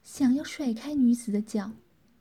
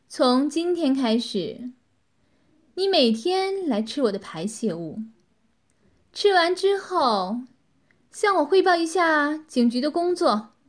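louder, commanding woman's voice; cold command; 4 semitones higher, 6 dB louder than usual. A narrator's reading. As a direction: lay down orders coldly. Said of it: "冷冷地说道".

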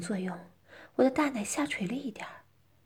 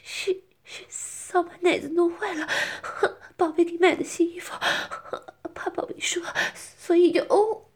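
much higher, gasping stammer; breathy, broken explanation, rising louder; breathless pleading; 8 semitones higher, 5 dB louder than usual. Acted. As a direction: plead haltingly between whimpers and moans. Strained, pleading explanation continues.

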